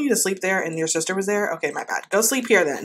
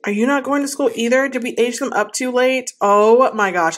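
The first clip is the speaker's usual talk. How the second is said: usual speaking voice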